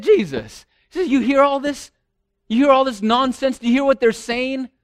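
scandalised, demanding he silence them; indignant priests. Retold or imitated. Priests complaining to Jesus, indignant.

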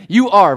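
firm stress on "You are"; emphatic affirmation. Shown as a demonstration. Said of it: "begins with an emphatic affirmation".

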